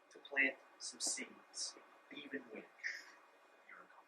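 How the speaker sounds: slow, soft whisper. Acted. Pastor whispering encouragement before prayer. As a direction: ease it out in a whisper.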